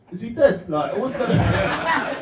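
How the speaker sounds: half-panicked, half-cracking up; shocked laugh at the sleeping manager; laughing alarm. Retold or imitated. Finding manager asleep on table.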